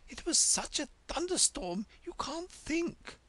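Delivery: high queen voice sinking to an unvoiced hush. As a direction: tell the storm breathlessly, fading to a whisper.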